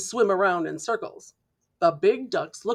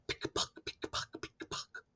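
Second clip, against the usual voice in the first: pick-a-puck fading to a whisper; clicking heels; 17 dB quieter than usual. A narrator's reading. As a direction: tap it out like high heels walking away. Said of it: "wearing high heels".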